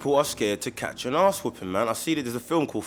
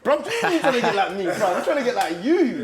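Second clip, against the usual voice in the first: laughing; 11 semitones higher, 5 dB louder than usual. Real, not acted. Laughing banter greeting a friend.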